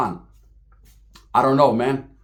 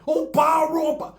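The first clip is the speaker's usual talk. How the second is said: high mock chant, much higher; mocking a furious rival; 10 semitones higher than usual. Mimicking the rival's meltdown chant, much higher mock yell. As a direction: squeal it like a hammed-up hothead. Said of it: "He went".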